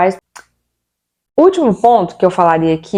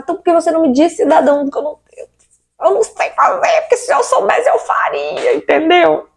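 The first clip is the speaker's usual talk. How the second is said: high, climbing, outraged protest; pleading outrage at the worker, pitch soaring; rising indignation; 10 semitones higher than usual. Acted. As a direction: build from annoyed to near-shrieking disbelief.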